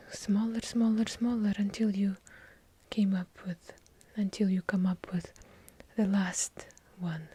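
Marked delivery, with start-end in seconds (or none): none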